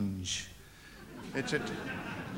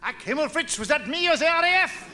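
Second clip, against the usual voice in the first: mock German; 8 semitones higher than usual. Comic German officer bit.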